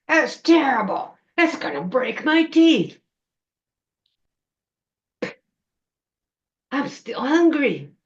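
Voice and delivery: high, loud creature complaint; cranky witch voice, indignant outburst; disgusted troll witch. Acted. Troll witch who bit a shoe, loud disgusted complaining.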